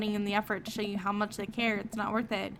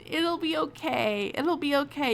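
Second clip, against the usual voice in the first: soothing; 5 semitones higher, 4 dB louder than usual. Real, not acted. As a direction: soothe her gently. Soothing the game daughter.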